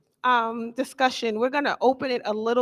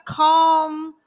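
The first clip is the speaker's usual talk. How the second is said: usual speaking voice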